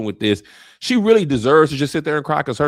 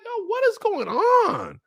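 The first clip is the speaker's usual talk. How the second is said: much higher, slow, stunned outcry; shocked disbelief; slower than usual. Retold or imitated. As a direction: react like you can't believe your eyes. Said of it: "I was like".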